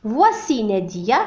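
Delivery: slow, careful teacher model; drawn-out classroom demo; slow model phrase. Shown as a demonstration. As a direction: say it slowly for the class to repeat. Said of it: "نقول voici".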